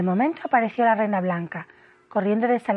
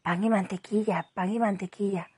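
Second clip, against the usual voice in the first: soft, slightly lowered repeated murmur; low voice; 5 dB quieter than usual. A narrator's reading. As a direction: say it under your breath, softly. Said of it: "repitiendo en voz baja".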